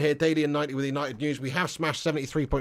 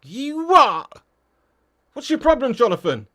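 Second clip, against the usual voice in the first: mock outrage; 7 semitones higher, 8 dB louder, slower than usual. Reacting to a viewer's comment.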